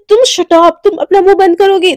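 loud, furious shut up; angry snap. Acted. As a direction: shout her down to silence her. Angry quarrel between lovers.

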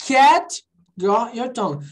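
"Cat got your tongue" drawn out, word by word; slow classroom model; slow pronunciation. Shown as a demonstration. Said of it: "quando eu falo devagar".

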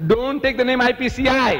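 high, emphatic re-enacted cry; mock inward shout. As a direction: act out the order as a shout. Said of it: "No, I didn't shout".

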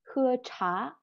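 Khưa trá with a breath of air on the ch; aspirated ch. Shown as a demonstration. Teacher modeling uống trà in Chinese, aspirated ch. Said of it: "C H bật hơi ra nhá".

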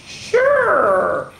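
high, drawn-out sure; eager acceptance. Acted. Girl accepting Baby's invitation to play, eager squeal. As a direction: agree in a squeal.

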